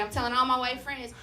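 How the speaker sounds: normal talking voice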